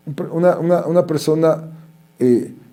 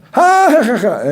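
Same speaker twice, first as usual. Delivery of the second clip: mock girlish laughter; 9 semitones higher, slower than usual. Classmates laughing.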